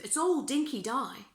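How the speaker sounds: usual speaking voice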